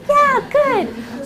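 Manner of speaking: high-pitched teacher cheer; upbeat praise. Acted. Teacher approving a kid's answer, high and upbeat.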